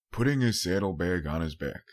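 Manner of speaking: plain reading voice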